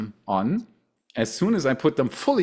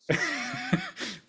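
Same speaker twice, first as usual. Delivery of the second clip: laugh; 8 dB quieter than usual. Real, not acted. Laughs after the headphone story.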